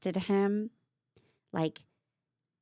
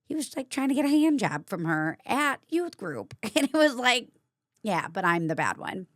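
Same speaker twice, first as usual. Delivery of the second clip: sarcastic outrage; 6 semitones higher, 4 dB louder than usual. Real, not acted. Outraged at hypocrisy, laughing.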